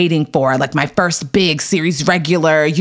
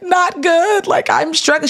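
breaking down; 10 semitones higher than usual. Retold or imitated. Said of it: "I just broke down".

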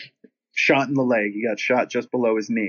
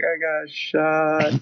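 very slow, drawn-out words, raised pitch; mock pitiful confession; feeble injured friend; 4 semitones higher, much slower than usual. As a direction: stretch it out like a woozy, hurt friend. Shot friend breaks the news.

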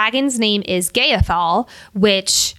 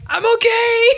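wounded but fine; 12 semitones higher than usual. Retold or imitated. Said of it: "Riddick is just in the corner with two arrows".

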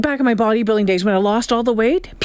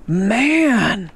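retold gushing amazement, hugely elongated; drawn-out amazement; 3 semitones lower, much slower than usual. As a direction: stretch the words right out, very slowly. Retelling acquaintance's over-emphatic weight remark.

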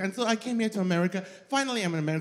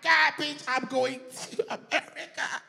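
excited quote; 8 semitones higher than usual. Retold or imitated. The young immigrant dreaming of America, a giddy high squeal. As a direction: gush like a giddy girl. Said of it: "I was like".

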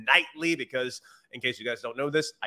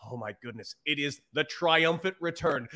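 usual speaking voice

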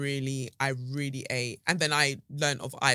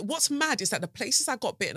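ordinary talk, nothing marked

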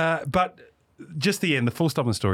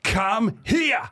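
high shout across the party; shouted call; 10 semitones higher than usual. Retold or imitated. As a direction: bellow come here at him. Duck calls him over.

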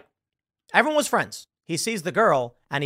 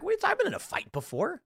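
high-pitched, incredulous comeback; retold bafflement; 14 semitones higher, 6 dB quieter than usual. Man answering a girl at the party, retold bafflement.

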